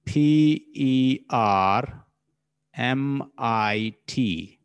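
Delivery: slow, separated letter by letter; spelled out slowly. Shown as a demonstration. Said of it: "How many syllables are in permit".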